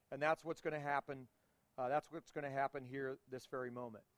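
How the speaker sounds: hushed earnest promise; sincere. Real, not acted. Quiet sincere turn to audience.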